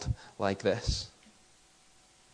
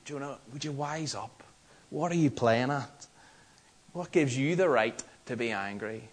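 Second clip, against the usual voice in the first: a mild mock telling-off, softer; accusatory; 3 dB quieter than usual. Preacher imitating a Northern Irish scolding of Jonah, mock accusatory. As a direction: wag a finger at Jonah, half-hearted. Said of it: "we read this as an accusatory question".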